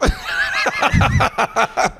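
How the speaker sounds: high burst of laughter. Real, not acted. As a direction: laugh out loud. Laughs at own story.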